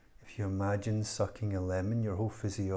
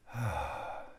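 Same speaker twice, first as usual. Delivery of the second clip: long, soft exhale; relaxed sigh; 5 dB quieter than usual. Real, not acted. Meditation teacher settling into stillness, relaxed sigh. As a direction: let the breath fall out in relief. And that feels nice.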